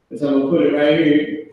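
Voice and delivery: usual speaking voice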